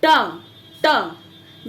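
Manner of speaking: ट as a crisp retroflex t with no vowel after; isolated phonic ट; phonic t sound. Shown as a demonstration. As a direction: clip ट short and hard, just the sound. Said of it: "टी letter का phonic sound क्या होगा?".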